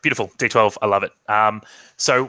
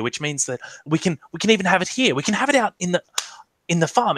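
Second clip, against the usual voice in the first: higher-pitched, eager hostess; acted excitement, stumbling over new ideas; excited; 7 semitones higher, faster than usual. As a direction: gush as the plans tumble out. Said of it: "she's excited".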